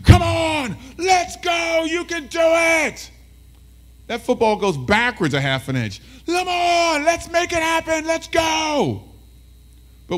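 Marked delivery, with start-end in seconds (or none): 0.0-3.1 high, excited shout from the stands; cheering fan. Acted. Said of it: "people are like". 6.2-9.0 frantic high-pitched shout of encouragement; cheering fan. Acted. Fans cheering a backwards move.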